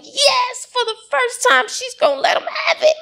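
high, giddy and fast, barely holding back a laugh; a wife relishing that her husband will get scolded; gleeful excitement. Retold or imitated. Wife recalling her thrilled inner thought, giddy and high.

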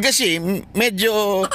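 usual speaking voice

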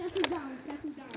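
low, hushed command; calm them down under your breath; quiet. Pretend. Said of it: "we need to be very quiet".

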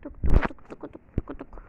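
slow clicked tik tik sounds; imitated ticking, whispered and slow; mock ticking noise. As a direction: tap it out like a clock.

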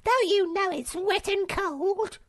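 very high, loud scolding call; scolding cry. A narrator's reading. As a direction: shout the warning down the beach. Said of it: "she cried".

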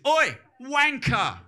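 slow, loud drawn-out Oi; high loud mock shout; London insult. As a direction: call it out across the street like a Londoner. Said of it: "they always go like this".

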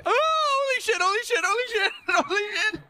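high, exaggerated mock panic; a hammed-up imitation of a friend's panic. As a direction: imitate his freak-out, way over the top. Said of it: "I don't sound like that, Brian".

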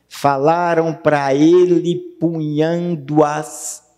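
slow, stretched "falaram pra ele punhando as"; syllable-by-syllable scansion. Shown as a demonstration. Teacher scanning the third line, slow scansion. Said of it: "na terceira linha".

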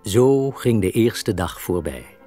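ordinary talk, nothing marked

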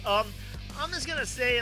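ordinary talk, nothing marked

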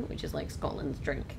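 usual speaking voice

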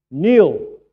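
a loud hall-filling shout of a name; booming call. Retold or imitated. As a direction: bellow down the corridor. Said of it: "and he went".

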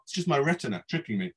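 plain reading voice

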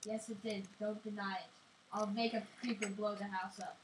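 ordinary talk, nothing marked

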